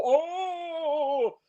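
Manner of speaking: high squeaky yelp of fright; mock yelp. Imitating Bond clinging to the tower with a high yelp. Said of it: "we hear him go".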